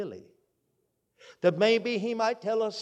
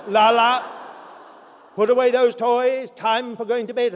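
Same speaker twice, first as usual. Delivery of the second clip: put-on loud call to a child, higher and louder; 5 semitones higher, 10 dB louder than usual. Mother calling her son to bed, loud and high. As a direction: call the boy to bed across the house.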